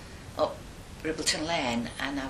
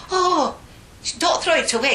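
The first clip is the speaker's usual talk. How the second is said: urgent protest; 13 semitones higher, 7 dB louder than usual. Retold or imitated. Acts friend's urgent plea for sandwich.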